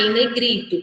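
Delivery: ordinary delivery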